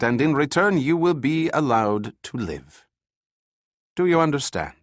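put-on lightly accented commander laying down the rules, cold and menacing; European accent. The colonel threatens the new prisoners.

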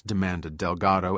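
ordinary delivery, nothing marked

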